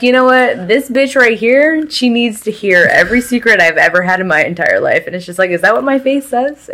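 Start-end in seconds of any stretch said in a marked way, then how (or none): 0.0-5.1 high, loud mock voice of a stranger unloading secrets; mocking oversharer. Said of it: "they're just like". 5.5-6.7 exasperated. Retold or imitated. Mock inner voice, exasperated.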